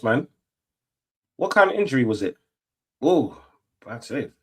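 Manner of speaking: ordinary talk, nothing marked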